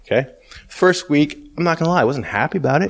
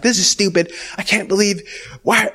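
high-pitched griping; whiny complaint; 8 semitones higher than usual. Retold or imitated. Man griping to the Holy Spirit, high and whiny. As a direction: gripe in a raised, whiny voice.

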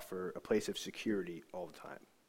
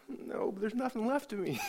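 high, small defeated voice; hammed-up helpless squeak; mock whimper; 11 semitones higher than usual. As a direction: plead it in a high-pitched whimper. Retold tearful reply to pastor.

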